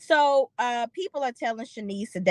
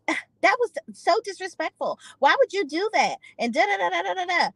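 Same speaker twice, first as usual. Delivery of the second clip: much higher whiny complaining voice; mock toddler tantrum; 10 semitones higher than usual. Imitating Bria scolding Simon, high mock tantrum. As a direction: whine it like a kid throwing a fit. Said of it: "she's throwing a toddler tantrum".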